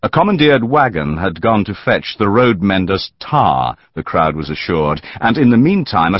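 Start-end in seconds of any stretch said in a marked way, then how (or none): none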